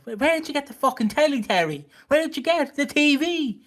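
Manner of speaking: imitating Bianca. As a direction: keep pressing the question, voiced high. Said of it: "Terry's like".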